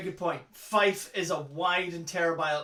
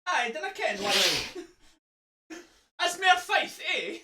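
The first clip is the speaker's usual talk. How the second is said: broad Fife dialect, pitched up; Fife accent; 8 semitones higher than usual. Shown as a demonstration. Friend answering a question about dialect, very Fife accent. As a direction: show off a thick Fife accent. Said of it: "That's very Fife".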